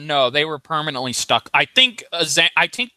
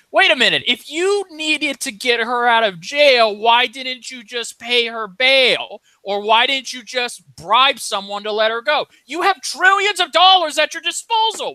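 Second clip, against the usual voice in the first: incredulous; 6 semitones higher, 3 dB louder than usual. Retold or imitated. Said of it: "which I'm like".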